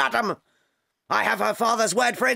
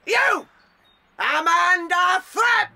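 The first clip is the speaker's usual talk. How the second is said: bellowed summons; 7 semitones higher, 4 dB louder, slower than usual. Acted. Headmistress calling out a pupil.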